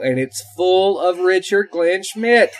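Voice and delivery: high, slowed hype with a laugh; excited. Real, not acted. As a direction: crow it high, drawing it out. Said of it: "this excited about anything".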